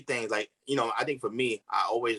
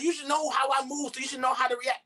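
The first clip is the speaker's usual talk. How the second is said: mock demanding partner; 10 semitones higher than usual. Quoting a partner's expectation.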